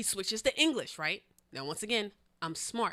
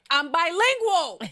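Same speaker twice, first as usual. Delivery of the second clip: high, loud, drawn-out declaration; emphatic boast; 8 semitones higher, 9 dB louder, slower than usual. Real, not acted. Insisting to co-host she speaks French, emphatic boast. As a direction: proclaim it slowly and proudly, way up high.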